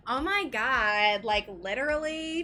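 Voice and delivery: put-on lampoon an American accent with a stretched "Oh my God"; mock American accent. British friend teasing Americans, imitated American accent. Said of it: "randomly do an American accent".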